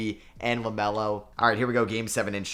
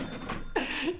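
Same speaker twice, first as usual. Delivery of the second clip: a high, soft delighted giggle; giddy high laugh; 6 dB quieter than usual. Real, not acted. Gamer whose big trade went through, giddy high laugh. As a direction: giggle with glee.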